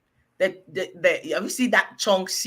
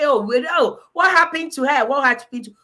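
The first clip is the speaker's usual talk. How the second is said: raised, louder voice of feigned shock; mock alarm; 3 semitones higher, 6 dB louder than usual. Imitating people reacting to someone's collapse, mock alarm.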